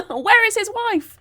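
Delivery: high, loud, slow, hammed-up outrage; mock dramatic question. Back in the bit, she asks viewers with hammed-up outrage. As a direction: ham up the puzzled demand.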